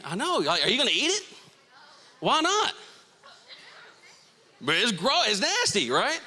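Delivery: high-pitched, grossed-out squeal of protest; mock disgust. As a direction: play up the yuck, voice jumping high. Playing disgust at mouldy strawberries.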